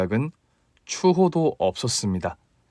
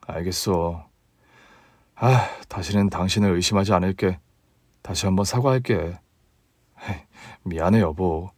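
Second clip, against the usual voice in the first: much lower, softer man's voice; a husband admitting he was fooled; low, soft apologetic husband voice; sheepish husband; 5 semitones lower, 5 dB quieter than usual. Acted.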